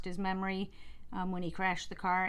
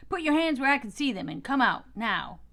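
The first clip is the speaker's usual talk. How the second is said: loud, fast, higher police order; barked command; 4 semitones higher, 5 dB louder than usual. Acted. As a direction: bark it out quickly, gun raised. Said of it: "he barked".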